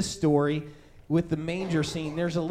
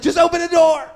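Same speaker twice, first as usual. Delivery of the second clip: much louder and higher call through the door; dad calling out; 11 semitones higher, 13 dB louder than usual. Retold or imitated. Dad telling his scared son to open the door, loud call. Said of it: "And then my dad's like".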